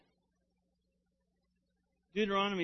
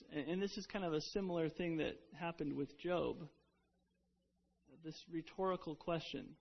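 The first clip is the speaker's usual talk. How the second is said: ordinary delivery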